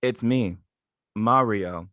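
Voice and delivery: mildly mocking diva voice. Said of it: "she'd be like this".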